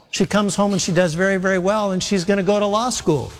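ordinary talk, nothing marked

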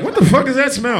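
hammed-up disgusted woman; disgusted girlfriend. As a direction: recoil at the smell in her voice. Girlfriend reacts to smell.

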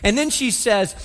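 ordinary delivery

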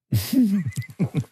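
short, high chuckle; amused laugh. Real, not acted. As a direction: laugh at the provocative question. Amused by vegetarian question.

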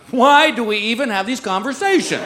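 high-pitched mock exasperation; exasperated husband. Husband arguing with his wife over a massage, exasperated.

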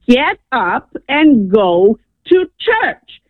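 very slow, each word drawn out; insistent third command. Retold or imitated. Mysterious voice ordering the sleeper a third time, insistent. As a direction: stretch "Get up and go to church" word by word. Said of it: "a third time it said".

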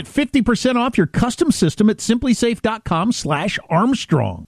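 upbeat sponsor read. Acted. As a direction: sell it like a radio ad. Reads sponsor ad.

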